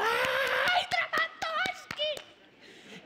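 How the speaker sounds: very high, slow, drawn-out squeal; swooning crowd. Retold or imitated. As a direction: swoon over the star poet. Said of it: "todo el mundo".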